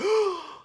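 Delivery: sharp high shocked gasp. Retold or imitated. Onlookers seeing his lost arm, a high shocked gasp. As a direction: gasp like the crowd at the reveal.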